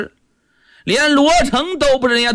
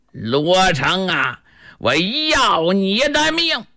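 slow, drawn-out death threat; vengeful threat; slower than usual. Acted. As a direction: stretch each word as you close in for revenge. Avenger advancing with a sword.

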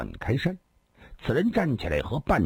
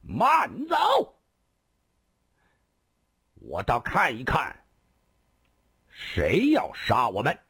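loud shout. A narrator's reading. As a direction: yell him to a halt. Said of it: "突然大喝一声". (大喝一声)

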